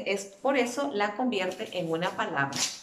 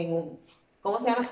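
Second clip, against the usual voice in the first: ordinary talk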